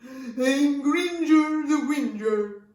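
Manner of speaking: whingy dragon, high and slow; loud, drawn-out whinge, pitched high; Gringer the Whinger. Acted. Dragon introducing himself to the girl, whinging loudly. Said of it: "I made the dragon answer back".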